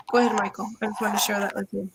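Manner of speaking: normal talking voice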